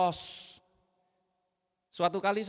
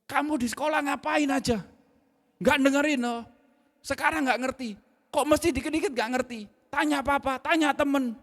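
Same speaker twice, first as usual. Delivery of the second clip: loud, much higher angry rant; angry scolding; 9 semitones higher, 6 dB louder than usual. Retold or imitated. Father scolding son at night, loud and angry. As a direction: berate the child furiously. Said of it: "Saya tuh sampai ngomong".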